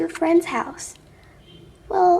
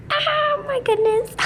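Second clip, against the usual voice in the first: excited squeal; 13 semitones higher than usual. Real, not acted. Sneak peek of new car.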